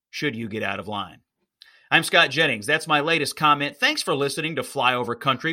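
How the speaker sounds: ordinary talk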